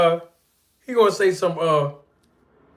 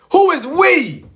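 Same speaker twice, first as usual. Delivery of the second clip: much higher, louder "Who is we?"; mock disbelief, pitched up; incredulous question; 10 semitones higher, 5 dB louder, slower than usual. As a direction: scoff at the claim. Mocking rhetorical question.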